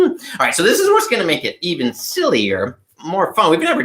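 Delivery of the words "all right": normal talking voice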